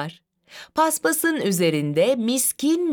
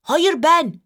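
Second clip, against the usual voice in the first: put-on high, insistent child's protest; objecting; 8 semitones higher, 4 dB louder than usual. A narrator's reading. Bartu objecting to Zeynep reading, insistently. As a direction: butt in to claim the turn. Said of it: "diyerek itiraz etti Bartu".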